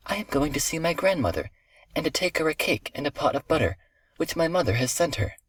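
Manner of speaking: put-on high-pitched innocent little girl. A narrator's reading. Little girl answers the wolf about her grandmother, high innocent child voice.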